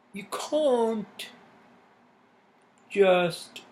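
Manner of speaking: slow, high, loud stab at a British accent; a hammed-up British accent, stretched out slowly; British accent attempt. Shown as a demonstration. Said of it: "I can do this for the British accent".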